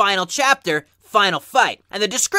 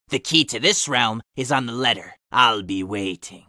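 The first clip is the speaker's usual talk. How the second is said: demon voice; 6 semitones lower than usual. Acted. Sonic.exe's blood letter to Steve, low menacing demon voice. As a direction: growl it from the underworld.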